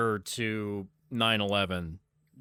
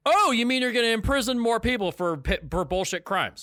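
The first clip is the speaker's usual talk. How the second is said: sarcastic; 10 semitones higher than usual. Real, not acted. Sarcastic retort.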